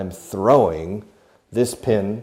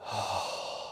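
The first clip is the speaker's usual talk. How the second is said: long audible exhale; soft unvoiced sigh outward; breathing out; 12 dB quieter than usual. Shown as a demonstration. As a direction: demonstrate letting the breath out. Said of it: "I'm breathing out".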